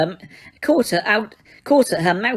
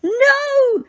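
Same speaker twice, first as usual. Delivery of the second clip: piercing, far higher cry; shriek; 3 dB louder than usual. A narrator's reading. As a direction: scream in pure panic. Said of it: "he heard Umbridge shriek".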